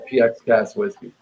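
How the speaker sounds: ordinary talk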